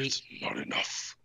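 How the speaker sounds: hushed, whispery deadpan impression; very quiet, unimpressed mock-villain whisper. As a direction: mimic a villain in a low whisper. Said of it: "Dude, he just so deadpan".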